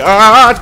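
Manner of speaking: loud startled shout; sudden yell. Real, not acted. Sudden yell ending song.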